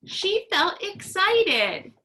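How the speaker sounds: teacher models excited feeling; acting excited. Shown as a demonstration. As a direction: act out being excited. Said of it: "So let's practice".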